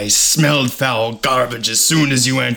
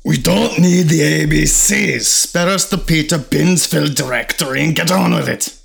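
loud, impatient, higher growl; angry shout; 7 semitones higher, 7 dB louder than usual. Acted. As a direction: bark it out, fed up. Agrippa snaps at demon.

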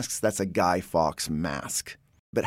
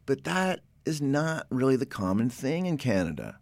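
usual speaking voice

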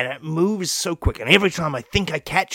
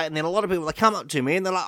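usual speaking voice

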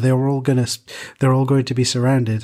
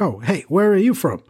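curious stranger; 6 semitones higher than usual. Retold or imitated. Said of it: "they're like".

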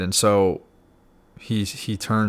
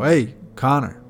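higher, louder, slow call of "Hey, Connor!"; retold yell; 5 semitones higher, 5 dB louder than usual. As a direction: call out to a friend from a passing car. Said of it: "he yells out the window".

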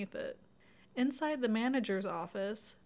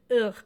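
disgusted groan; 12 dB louder than usual. Real, not acted. Host hates this skeezy guy, disgusted groan. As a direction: groan out your loathing. Reacting to a skeezy film character.

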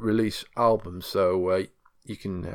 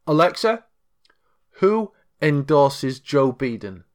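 slow clear voice command; 4 semitones higher, 4 dB louder, slower than usual. Acted. Asking the smart speaker a question.

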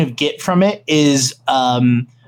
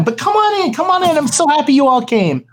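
much higher, gushing host voice; effusive host welcome; 9 semitones higher than usual. Acted. Party host welcoming the guests at the door, much higher and gushing. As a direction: welcome them in with delight.

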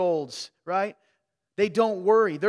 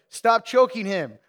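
mock scolding; 4 semitones higher, 9 dB louder, slower than usual. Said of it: "Jill was like".

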